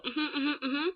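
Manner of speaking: high mock mm-hmms; imitated listener. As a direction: lightly mimic someone humming along in agreement. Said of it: "it would go".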